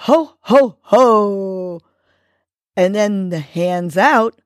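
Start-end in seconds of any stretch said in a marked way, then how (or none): 0.1-1.8 ho ho ho. Shown as a demonstration. Host showing listeners the hands-up laugh, hearty ho ho ho. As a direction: belt out a jolly ho ho ho. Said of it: "and say".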